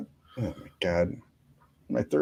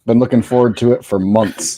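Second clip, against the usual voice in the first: sarcastic; 13 dB louder than usual. Pretend. Mock enthusiasm about sub.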